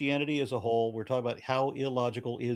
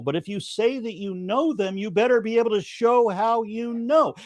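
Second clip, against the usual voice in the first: higher-pitched, emphatic explaining; exasperated insistence; 7 semitones higher, 5 dB louder than usual. Real, not acted. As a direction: drive the point home, pitch climbing as you go. Debater exasperated at being misunderstood.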